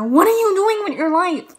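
loud, high-pitched mock outrage; mock scolding. Vlogger jokingly scolds anyone not following her on Twitter, mock outrage. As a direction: shout it as a playful telling-off.

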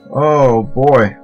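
ordinary talk

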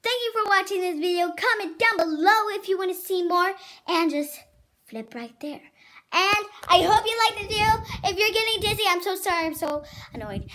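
high, loud, fast YouTuber sign-off; vlog outro. Acted. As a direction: pump up the viewers to like and subscribe.

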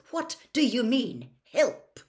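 high, tight, indignant question; constricted voice. A narrator's reading. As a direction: challenge the offer through a tight throat. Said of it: "in a constricted voice".

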